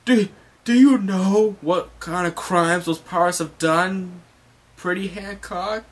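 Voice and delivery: high, swooning admiral voice; lovestruck admiral. Retold or imitated. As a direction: gush like he has hearts in his eyes. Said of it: "he's like".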